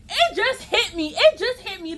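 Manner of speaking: ordinary delivery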